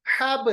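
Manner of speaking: हब with b bounced into "habe"; qalqalah. Shown as a demonstration. Teacher asks and answers, हब with echoed b. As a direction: let the b of हब spring open. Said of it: "कैसे पढ़ते हैं?".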